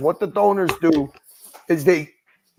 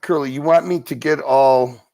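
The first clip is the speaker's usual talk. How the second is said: ordinary talk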